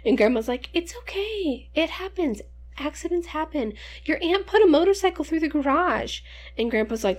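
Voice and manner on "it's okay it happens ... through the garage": higher, soothing grandma voice; comforting grandma. Retold or imitated. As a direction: reassure the child like a kind grandmother. Grandma comforting after the crash.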